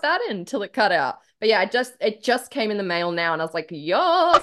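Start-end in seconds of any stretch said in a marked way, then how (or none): 3.7-4.4 higher excited exclamation; excited yes. Retold or imitated. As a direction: re-enact your delight, pitched up. Acting out excitement at mail arriving.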